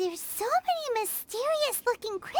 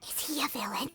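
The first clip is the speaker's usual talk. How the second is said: whispered, conspiratorial question; a hushed, wary whisper about a possible villain; suspicious whisper; 10 semitones lower, 7 dB quieter, faster than usual. Acted. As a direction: lean in and whisper it like a secret.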